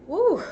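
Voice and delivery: high, loud "woo"; big high exhale of relief from coughing; coughing-fit whoop. Real, not acted. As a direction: whoop it out after a hard cough. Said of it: "take care of my cough".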